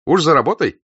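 bright, loud cheery hail; cheerful greeting. A narrator's reading. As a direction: call out a happy greeting. Rostov greets German cheerfully.

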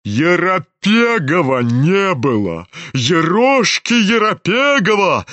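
drawn-out outraged cry, rising in pitch; frenzied exclamation. A narrator's reading. General is outraged at his son, crying out. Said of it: "вскричал он в выступлении".

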